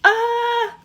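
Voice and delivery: high, drawn-out "ah"; pained cry. Real, not acted. Peeling sticky clay off the face, high pained cry. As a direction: cry out as it pulls at the skin.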